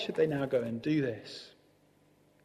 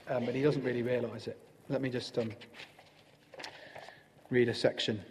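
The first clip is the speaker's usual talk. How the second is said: normal talking voice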